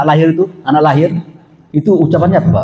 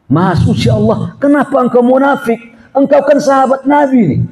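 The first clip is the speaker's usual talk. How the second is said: startled Abu Bakar; 9 semitones higher than usual. Retold or imitated. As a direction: gasp out subhanallah in mock shock. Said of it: "Apa kata Abu Bakar?".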